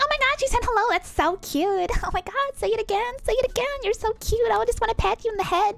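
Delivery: very high, gushing American squeal; cooing American. Acted. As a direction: coo in a fake American accent as if at a puppy. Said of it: "they're like".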